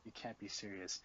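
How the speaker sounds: low, very soft, deflated; barely voiced disbelief, retold; quiet dismay. Friend finding the mess on the bed, quiet dismay. Sees vomit, appalled.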